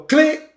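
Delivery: high, sharp click sound; a squeaky imitated igniter click; mock click. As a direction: pop the click up high like a lighter. Mimicking the grill igniter.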